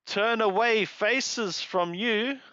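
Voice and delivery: normal talking voice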